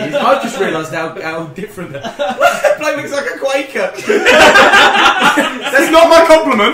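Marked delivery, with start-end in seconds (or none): 2.2-6.7 high squealing laugh through the words; can barely get it out for laughing, slowly. Real, not acted. Guest cracking up at his friend's Quaker hat, laughing hard.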